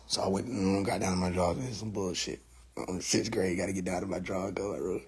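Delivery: very soft, low grumble; low, hushed complaint while obeying; grumbling to himself. Retold or imitated. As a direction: mutter resentfully under your breath.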